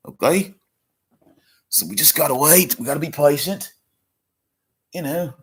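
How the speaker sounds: high, excited Australian wildlife-host voice, mock Australian accent; hushed Australian nature-show host stalking his prey; crocodile hunter impression. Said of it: "I gotta do my crocodile hunter".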